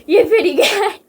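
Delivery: laughing taunt. Acted. Snake taunting the sniper, laughing. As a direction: chuckle the compliment out.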